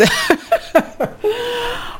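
high, soft run of giggles; real laugh. Real, not acted. Host reacting to a cheater's letter, amused laughing. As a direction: laugh helplessly before answering.

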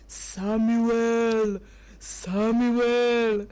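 slow, drawn-out low call; God's calling voice. Acted. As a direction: call the sleeper with long, even syllables. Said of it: "Samuel heard a voice".

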